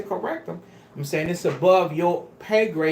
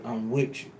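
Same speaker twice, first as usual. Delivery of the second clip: lower, softer, reassuring tone to an angry husband; gentle reassurance; 10 semitones lower, 7 dB quieter than usual. Shown as a demonstration. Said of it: "Have the reverence ... and say".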